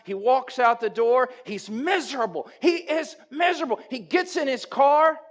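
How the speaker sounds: loud, high preacher's build-up; impassioned sermon storytelling; full-force preaching. Acted. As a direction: drive the story home from the pulpit.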